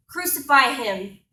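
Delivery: loud shout at a held-back pitch; crowd's scream. Acted. Mob calling for Christ's death in a poem, shouting. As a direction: call it out over the crowd. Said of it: "they're screaming in my face".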